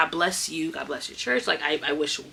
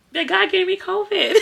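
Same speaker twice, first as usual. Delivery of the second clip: high and louder, breaking into a laugh; 13 semitones higher, 5 dB louder than usual. Real, not acted. Telling viewers God gave her covid, laughing. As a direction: laugh through the punchline.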